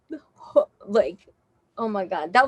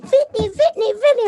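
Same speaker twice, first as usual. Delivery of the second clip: higher, louder mock call of a name; mock calling her name; 11 semitones higher, 5 dB louder than usual. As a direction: hail her from the van door. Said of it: "they open it and they're like".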